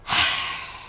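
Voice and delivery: heavy, audible exhale; demonstrated sigh. Shown as a demonstration. Trainer showing caregivers what sets a person off, heavy sigh. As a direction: let out a long, put-upon sigh. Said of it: "the body posture and the sigh".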